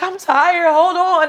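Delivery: high, whiny plea for a break; mother too exhausted to push, pleading; tired plea. Retold or imitated. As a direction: beg them to wait. Exhausted mid-labor.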